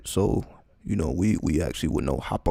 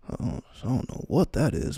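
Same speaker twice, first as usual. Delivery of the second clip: usual speaking voice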